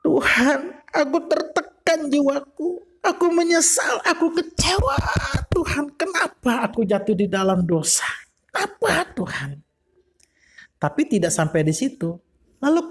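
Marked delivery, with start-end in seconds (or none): 0.0-9.6 high, tearful plea to God; weeping David. Acted. David weeping to God over his sin, high and tearful. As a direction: cry out to God in remorse. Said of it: "Daud sedang menangis".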